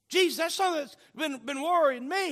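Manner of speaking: loud, high, insistent plea as Philip; worried interjection. Retold or imitated. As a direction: press the worry urgently, stumbling slightly. Acted Philip voicing his worry.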